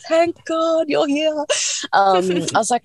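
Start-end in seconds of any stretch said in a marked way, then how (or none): 0.1-1.3 high, slow, drawn-out gratitude; retold huge relief. Labouring woman to the anaesthetist, hammed-up high relief. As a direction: stretch it out high, like greeting a saviour.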